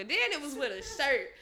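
ordinary talk, nothing marked

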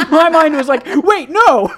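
loud, high laughing outburst; laughing shout. Real, not acted. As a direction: shriek it out through laughter. Laughing panicked realisation.

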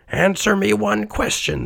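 high-pitched imploring request; Scrooge. A narrator's reading. Old miser presses the silent ghost, high pleading voice. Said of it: "asked Scrooge".

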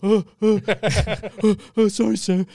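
high, stammered mock panic; mock cowering apology. Imagined meek customer apologising to the man, mock cowering.

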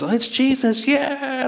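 high, drawn-out cheer of greeting; excited welcome. Acted. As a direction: stretch the cheer out high, without shouting. Family greets arriving Jesus.

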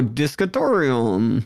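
"discatorium" said slowly with D for B; "discatorium", lips still, D replacing B; ventriloquist B as D. Shown as a demonstration. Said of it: "you're supposed to go".

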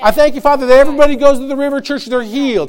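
loud, fervent thanksgiving prayer; preacher voicing a believer's bold prayer of thanks; confident prayer. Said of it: "all he does is speak what God has already told him".

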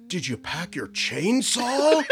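slow, high mock-dad question; Keanu Reeves riffing. Imitating a dad riffing with his kid, slow and high. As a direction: stretch it out in a silly high voice. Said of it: "Keanu was like".

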